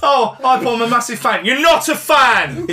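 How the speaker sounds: high, gushing mock fan.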